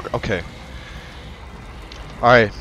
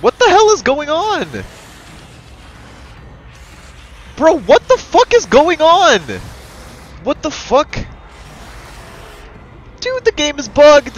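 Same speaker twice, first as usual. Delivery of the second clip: bewildered yelling; 12 semitones higher than usual. Real, not acted. Player yelling that the game is bugged, bewildered shouts. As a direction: shout in baffled disbelief. Game glitch confuses him.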